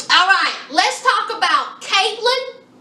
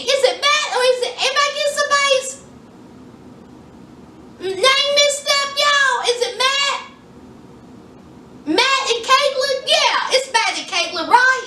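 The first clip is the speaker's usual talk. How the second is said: much higher uncertain questions; high puzzled self-check; 7 semitones higher than usual. Real, not acted. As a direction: ask the room, unsure and high. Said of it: "confused over names, high".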